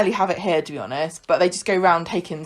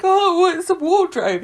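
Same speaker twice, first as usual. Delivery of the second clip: meek reply; 9 semitones higher than usual. Retold or imitated. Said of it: "And you're like".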